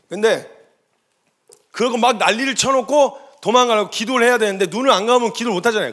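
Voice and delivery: ordinary talk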